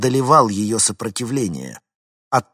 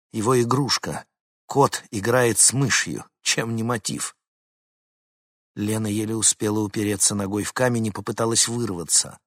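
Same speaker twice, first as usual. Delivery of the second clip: ordinary delivery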